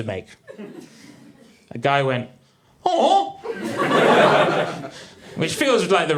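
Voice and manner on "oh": loud, high-pitched mock "oh"; imitated sympathetic oh. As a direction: let out an exaggerated high oh. Audience member's reaction noise, imitated.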